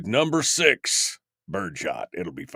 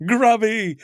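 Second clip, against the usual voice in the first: mock shout; 12 semitones higher, 6 dB louder than usual. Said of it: "says Bill".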